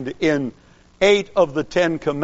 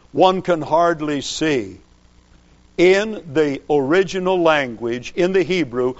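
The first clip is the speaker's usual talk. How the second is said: ordinary talk, nothing marked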